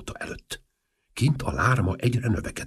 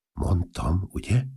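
ordinary delivery, nothing marked

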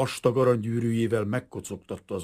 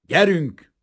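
short and blunt, a touch louder; detective's curt, louder order; 5 semitones higher, 8 dB louder than usual. A narrator's reading. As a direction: cut him off curtly. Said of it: "Mondott az egyik detektív kurtán".

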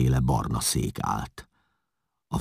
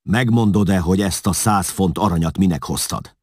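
loud, high angry demand; furious interrogation, voice raised; irritated, angry; 6 semitones higher, 7 dB louder than usual. A narrator's reading. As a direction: demand an answer, losing patience. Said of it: "A kardját ingerülten dobbantotta".

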